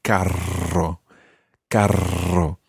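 quadruple trilled R in "carro"; over-rolled R. Shown as a demonstration. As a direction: exaggerate the roll on "carro". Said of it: "I'm obviously overruling my R's".

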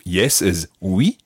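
slow, clear "yes is oui"; slow recap. Shown as a demonstration. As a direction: spell it out slowly. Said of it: "lesson recap".

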